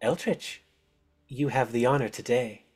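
high, swooping voice of a witchy overlady; Baba Yaga, grand hostess. Acted. Baba Yaga greeting her players, high and ceremonious.

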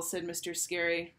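usual speaking voice

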